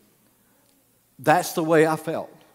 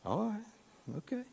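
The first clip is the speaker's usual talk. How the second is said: resigned all right; 6 semitones higher, 14 dB quieter, slower than usual. Retold or imitated. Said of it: "I'm like".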